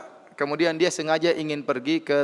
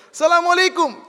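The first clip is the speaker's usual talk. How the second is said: shouted greeting, much higher and louder; 7 dB louder than usual. Retold or imitated. Police chief greeting the idol in the temple, shouting. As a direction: call the greeting out at full volume. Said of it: "saya sengaja keras".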